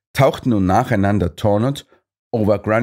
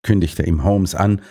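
ordinary delivery, nothing marked

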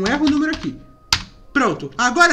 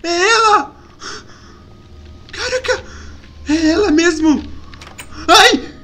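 scared recognition; 3 semitones higher, slower than usual. Acted. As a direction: realize in fright who it is. Recognizes the creature outside. (assustado, voz aguda de espanto)

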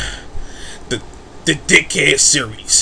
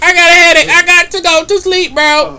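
whiny sim voice; 12 semitones higher, 4 dB louder than usual. Retold or imitated.

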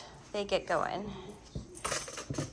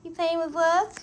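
high laughing puppy talk; 6 semitones higher than usual. Real, not acted.

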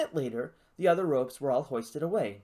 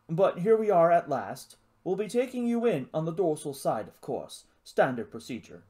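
put-on louder, brisk posh English accent; clipped officer reciting rules in posh English; 5 dB louder than usual. Lizard explains docking to the cadet, posh English accent. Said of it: "It spoke with a posh accent".

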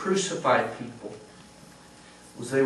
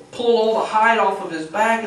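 ordinary talk

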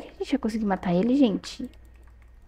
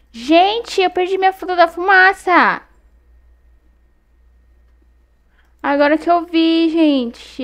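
much higher and louder, distraught wail; dismayed outcry; 7 semitones higher, 7 dB louder, slower than usual. Real, not acted. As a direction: cry out at the loss. Realizes she lost her fruit.

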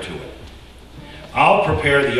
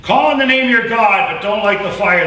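higher, louder, defiant challenge; Elijah's challenge; 8 semitones higher, 6 dB louder than usual. Acted. Elijah challenges Baal's prophets with a defiant shout. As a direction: throw the dare at them. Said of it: "Elijah said".

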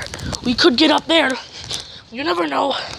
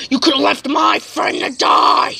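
loud accusing shout; angry shout; 3 semitones higher, 5 dB louder than usual. Acted. Accusing a companion who left his friend, in a furious shout. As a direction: hurl the blame at him, shouting.

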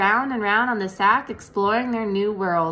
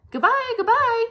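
very high, louder little voices; tiny spiders calling; 4 dB louder than usual. A narrator's reading. Baby spiders call goodbye to Wilbur, very high. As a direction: chirp a farewell. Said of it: "they called".

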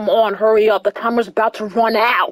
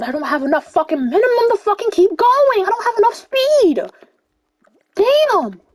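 high-pitched, rising frantic complaint; exasperated outburst; 9 semitones higher than usual. Real, not acted. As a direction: complain urgently as the speed runs out. Losing momentum in the game.

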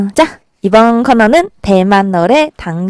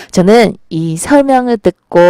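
usual speaking voice

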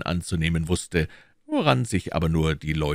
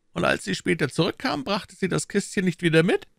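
higher, pressing questions as the Cardinal; Cardinal questioning; 14 semitones higher than usual. Acted. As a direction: interrogate his agent coolly. (der Kardinal, verhörend)

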